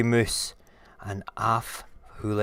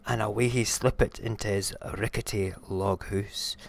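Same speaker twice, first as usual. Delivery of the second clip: usual speaking voice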